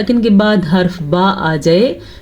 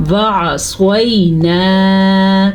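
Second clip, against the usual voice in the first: slow melodic tajweed recitation; Quran recitation; much slower than usual. Shown as a demonstration. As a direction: draw the vowels out like a Quran reciter. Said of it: "پھر سے سنیں".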